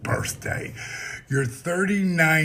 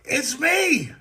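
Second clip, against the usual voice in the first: excited; 12 semitones higher than usual. Acted. Big excited self-introduction.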